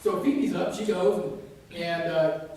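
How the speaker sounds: normal talking voice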